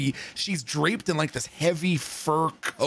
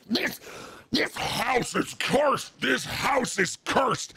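slow, higher, rough doom-laden holler; mock screaming; 7 semitones higher, slower than usual. Woman still sitting there cursing the house, mock screaming. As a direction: drag it out like a hoarse street prophet. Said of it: "she's sitting there just screaming".

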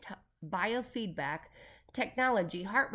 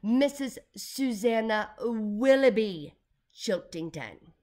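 very slow, loud, grand name; stately operatic self-introduction; 2 semitones higher, 8 dB louder, much slower than usual. A narrator's reading. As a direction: draw out each name like a diva. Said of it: "the lady said operatically".

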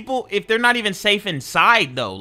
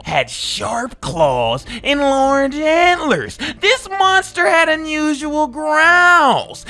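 slow, dramatic spooky read in a strange voice; spooky letter reading; 7 semitones higher, 3 dB louder, much slower than usual. Acted. Gamer reading the townsfolk a monster warning, slow and spooky. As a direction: read the warning like a horror story.

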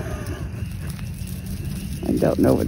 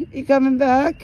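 high cooing voice; 10 semitones higher than usual. Real, not acted. Cooing at goats, high pitch.

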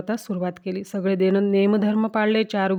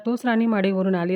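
usual speaking voice

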